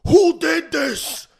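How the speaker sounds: slow, high, anguished wail over the dog; anguished cry. Retold or imitated. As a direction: wail it out as if your dog was mauled. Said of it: "And I'm like".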